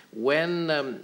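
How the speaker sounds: normal talking voice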